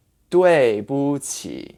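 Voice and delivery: 对不起 slow, 不 glided over with no tone; neutral middle tone. Shown as a demonstration. Teacher models the phrase, neutral middle tone. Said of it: "glide over it".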